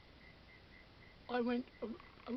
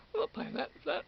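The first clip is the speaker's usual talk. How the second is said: higher and louder, outraged; telling the checkout lady off, louder and outraged; louder indignant refusal; 9 semitones higher, 6 dB louder than usual. Retold or imitated. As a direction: push the refusal harder, fed up.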